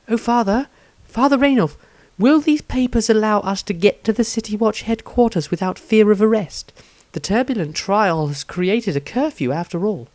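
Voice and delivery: calling the priest gently, pitched well up; Rosandria, pleading. A narrator's reading. Rosandria voiced as character.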